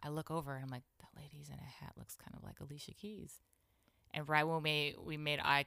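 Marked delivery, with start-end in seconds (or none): 1.0-3.4 soft, whispery retold discovery; half-whispered celebrity spotting; hushed aside. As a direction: murmur it secretly under your breath.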